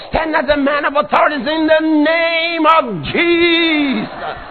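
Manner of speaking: normal talking voice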